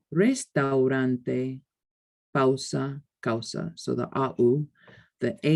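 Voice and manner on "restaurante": restaurante said very slowly, au gliding as one sound; slow demo. Shown as a demonstration. Teacher modelling the au diphthong in restaurante. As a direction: draw out restaurante syllable by syllable. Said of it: "A U is au".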